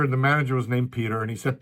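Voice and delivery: ordinary delivery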